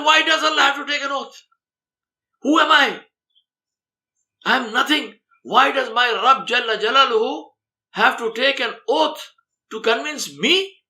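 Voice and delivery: high, loud, anguished outcry of a repentant man; despairing cry. Acted. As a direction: cry it out as if you are nothing before God. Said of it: "as if he's in despair".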